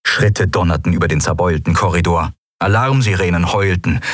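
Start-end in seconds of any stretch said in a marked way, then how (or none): none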